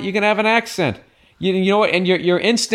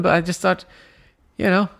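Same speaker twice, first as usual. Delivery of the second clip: ordinary talk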